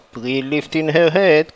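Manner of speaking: ordinary delivery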